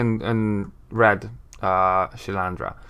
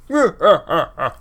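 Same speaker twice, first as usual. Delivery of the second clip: big booming laugh, pitched up; bellowing laugh; 7 semitones higher, 4 dB louder than usual. Acted. Braskin laughs at the news, bellowing. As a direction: let out a hearty acted bellow of laughter. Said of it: "Braskin lets out a great bellow of laughter".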